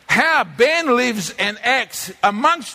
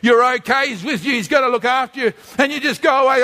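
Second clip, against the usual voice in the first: ordinary delivery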